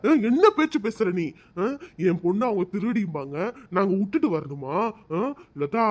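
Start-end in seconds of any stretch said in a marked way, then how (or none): 0.1-1.2 loud, sharp rebuke of his wife; husband yelling at his wife, angry; angry shout. Acted. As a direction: shout her down in anger.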